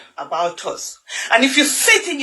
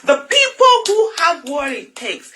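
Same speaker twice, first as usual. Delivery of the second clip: impassioned; 8 semitones higher than usual. Real, not acted. Rising passionate outcry.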